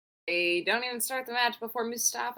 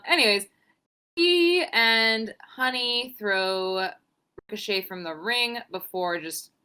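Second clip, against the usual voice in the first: ordinary talk, nothing marked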